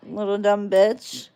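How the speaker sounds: ordinary talk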